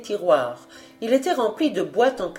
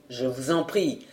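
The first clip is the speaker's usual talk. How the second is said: low, much softer, soothing; calm reassurance; 7 semitones lower, 7 dB quieter than usual. Acted. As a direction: settle him down gently. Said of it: "énergique et amical". («je vous en prie» dit bas et très doux, rassurant)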